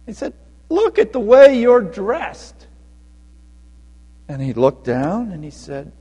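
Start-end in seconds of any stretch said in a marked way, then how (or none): 0.7-2.5 higher, louder, drawn-out disapproval; disapproving guard. Acted. Guard to the old man about his clothes, disapproving. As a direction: scold a visitor slowly and pointedly.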